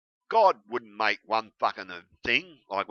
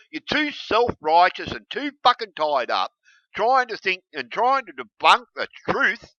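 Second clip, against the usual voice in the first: usual speaking voice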